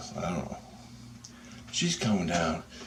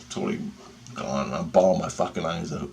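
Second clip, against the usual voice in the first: ordinary delivery, nothing marked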